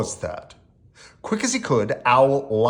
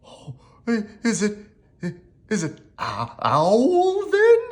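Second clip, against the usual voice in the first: stammering, rising to a high, louder finish; nervous gulping; 8 semitones higher, 3 dB louder than usual. A narrator's reading. Rabbit asks if Owl is knocking, nervous stammer. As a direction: falter nervously, then pitch up. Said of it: "Rabbit gulped".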